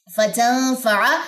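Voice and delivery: फतनफा slowly, short ain vowel; demonstrated pronunciation. Shown as a demonstration. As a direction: say फतनफा slow and clean. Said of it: "अब आइन की जबर भी अक्सर लोग लंबी कर देते हैं". (फतनफा धीरे और छोटी ज़बर के साथ)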